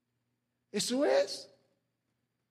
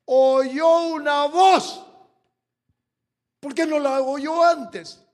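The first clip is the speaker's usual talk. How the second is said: loud, drawn out, higher; slow preaching shout; 6 semitones higher, 13 dB louder, slower than usual. Real, not acted. Pastor stressing the voice Saul heard, slow shout. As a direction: stretch it out at full voice.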